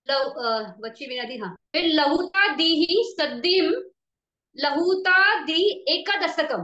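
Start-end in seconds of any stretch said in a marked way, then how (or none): 1.8-6.6 slow, louder scriptural recitation; emphatic recitation. Shown as a demonstration. Teacher reciting the next category from the text, slow loud recitation. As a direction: draw out each term and project it.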